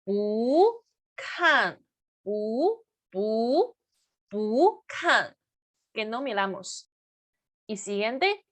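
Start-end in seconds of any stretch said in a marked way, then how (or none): 0.0-5.3 "bu" drawn out and rising, "kan" falling; second tone on bu. Shown as a demonstration. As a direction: lift every "bu", drop "kan". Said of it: "se convierte en el segundo tono".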